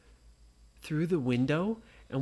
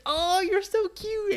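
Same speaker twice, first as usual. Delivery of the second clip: very high, slow adoring coo; gushing at a pet in her arms; 4 dB louder than usual. Acted. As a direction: squeeze it with your voice. Nora hugs the turtle.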